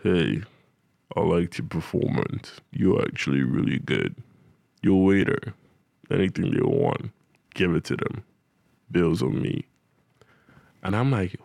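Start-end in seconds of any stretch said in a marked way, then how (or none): 0.0-9.6 deep-voiced big spender. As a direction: drop your voice low like a smooth baller. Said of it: "he has this, like, really deep voice".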